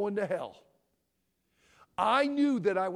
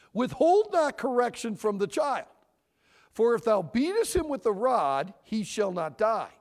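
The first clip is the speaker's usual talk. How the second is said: loud, preaching Bible reading; forceful scripture reading; 3 semitones higher, 9 dB louder than usual. Acted. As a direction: proclaim the verse to the congregation. Reads scripture aloud forcefully.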